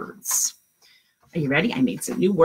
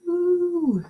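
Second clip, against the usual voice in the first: usual speaking voice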